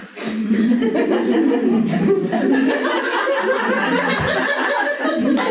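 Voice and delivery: high, open laugh breaking out; big burst of laughter. Real, not acted. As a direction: laugh freely at her own joke. Audience laughs at her joke.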